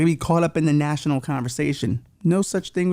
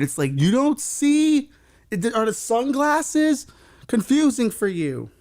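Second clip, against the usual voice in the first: high, plaintive plea for support; begging; 5 semitones higher than usual. Acted. Host asking listeners to save him from nine-to-five, begging. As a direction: beg in a raised, needy voice. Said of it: "'cause I'm done begging".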